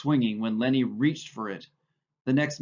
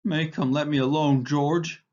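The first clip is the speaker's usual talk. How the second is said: pleading in terror; 3 semitones higher, 3 dB louder than usual. A narrator's reading. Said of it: "he cried".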